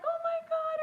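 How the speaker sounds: very high, loud, frantic oh my God; frantic shriek. Retold or imitated. Mom retelling her freak-out over the bleeding turkey, shrieking. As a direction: shriek it in retold panic.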